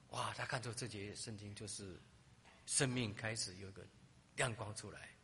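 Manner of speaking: ordinary talk, nothing marked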